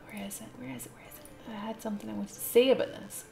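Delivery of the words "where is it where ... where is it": softer and softer until a whisper; muttered search. Real, not acted. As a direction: mutter to yourself, fading to a whisper.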